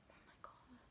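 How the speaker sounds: breathless unvoiced whisper; scared little whisper. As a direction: hush it so nobody wakes. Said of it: "I was whispering".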